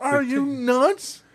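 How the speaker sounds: high, slow, stretched-out disbelief; comic high-pitched incredulity; incredulous question. Retold or imitated. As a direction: ask it like he's lost his mind. Incredulous reaction to cop's order.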